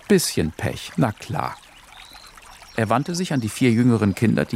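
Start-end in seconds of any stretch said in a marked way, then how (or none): none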